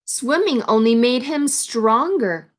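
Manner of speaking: ordinary delivery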